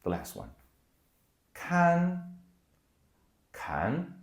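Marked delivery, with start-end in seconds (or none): 1.5-2.2 loud "Kan" held high and level; first tone, flat. Shown as a demonstration. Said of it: "let's do a third one". 3.5-4.1 "kan" gliding upward like a question; second tone, rising. Shown as a demonstration. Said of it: "let's do a third one".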